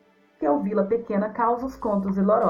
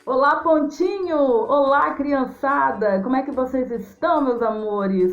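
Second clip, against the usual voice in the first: excited greeting; 5 semitones higher, 5 dB louder than usual. Real, not acted. Storyteller greets children on show.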